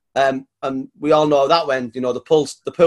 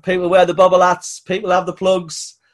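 ordinary talk

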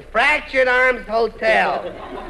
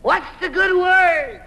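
calling out; 8 semitones higher, slower than usual. Acted. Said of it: "just listen to this echo".

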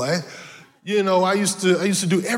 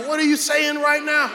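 self-scolding; 6 semitones higher than usual. Retold or imitated. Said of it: "you like".

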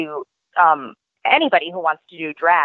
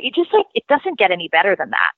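ordinary talk, nothing marked

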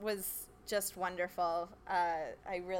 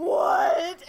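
loud high shocked yelp; 9 semitones higher, 13 dB louder than usual. Retold or imitated. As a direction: blurt it out in shock. Shocked reply to the dad.

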